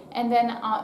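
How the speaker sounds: ordinary talk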